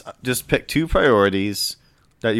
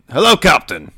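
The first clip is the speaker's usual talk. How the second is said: put-on loud, higher Castilian-accented greeting; 5 semitones higher, 7 dB louder than usual. Castilian woman greets the captain at the navigator's table, Castilian accent. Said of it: "but with a Castilian accent".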